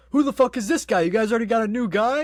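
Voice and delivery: hammed-up furious demand; mock angry shout. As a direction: shout it at a room held at gunpoint. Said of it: "then he goes".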